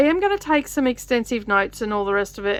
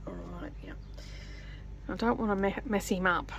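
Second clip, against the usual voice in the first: normal talking voice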